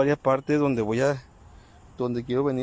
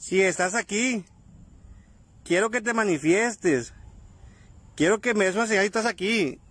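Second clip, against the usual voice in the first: calling to the spirit; 5 semitones higher than usual. Real, not acted. Questioning the spirit aloud.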